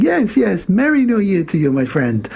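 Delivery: high mock-African accent, easing down; crazy African accent. Exchange student correcting Beaks, crazy African accent. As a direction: insist cheerily in a put-on African accent. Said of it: "he's putting on this crazy African accent".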